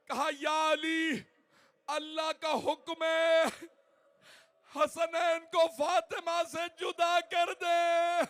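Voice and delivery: mourning cry over the bier; full-force wailing elegy. Acted. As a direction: wail the lament at full force.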